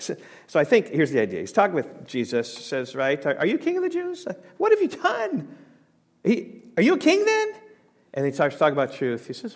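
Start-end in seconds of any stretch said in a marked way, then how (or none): 3.2-7.5 much higher, incredulous questioning voice; Pilate questioning. Retold or imitated. Pilate questioning Jesus, high and incredulous. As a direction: voice a disbelieving Pilate, pitched way up.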